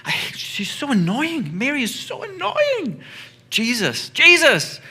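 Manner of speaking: high, whiny mock complaint building to a loud cry; hammed-up annoyance. Martha complaining to Jesus about Mary, hammed-up annoyance. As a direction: squeal it like a fed-up sister tattling. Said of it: "getting a little bit mad at her sister".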